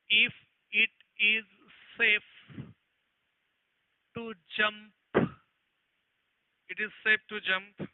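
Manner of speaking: slow, word-by-word read-out of the question; slow dictation. Acted. Teacher reading an exam question to the class, slow dictation. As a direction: read it out so students can copy it down.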